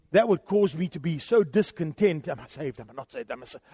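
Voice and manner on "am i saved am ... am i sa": hushed, much softer fretful muttering; mock anxious doubt. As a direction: imitate a nervous, unsure believer. Mocking a doubting believer.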